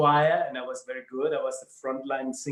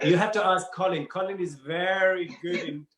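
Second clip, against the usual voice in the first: ordinary delivery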